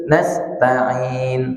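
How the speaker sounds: Nasta'in drawn out smoothly without a break; smooth unbroken Arabic recitation; flowing recitation. Shown as a demonstration. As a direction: let Nasta'in flow in one breath. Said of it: "Tapi alirkan saja".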